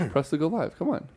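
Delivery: ordinary delivery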